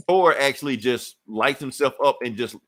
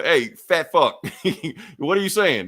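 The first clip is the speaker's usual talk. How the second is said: raised, louder laughing jab at a fat friend; mock yell; 6 semitones higher, 4 dB louder than usual. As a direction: bark it out half-laughing, louder. Thor yelling at Volstagg, retold.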